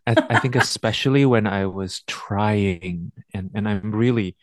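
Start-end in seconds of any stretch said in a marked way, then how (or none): 0.3-3.1 "trying" stretched and punched for emphasis; stress on TRYING. Real, not acted. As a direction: lean hard on "trying", drawn out slowly. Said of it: "emphasizing the word trying here".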